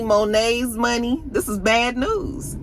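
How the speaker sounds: normal talking voice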